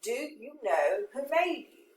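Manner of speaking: slowed, plainer modern wording; slow paraphrase. Shown as a demonstration. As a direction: read slowly to make it easier. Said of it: "that might make it a little bit easier".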